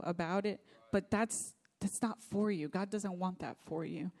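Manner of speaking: usual speaking voice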